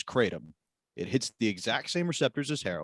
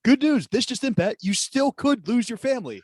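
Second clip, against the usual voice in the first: much higher, louder newsreader mock; mock news flash; 12 semitones higher, 4 dB louder than usual. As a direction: announce it like breaking news.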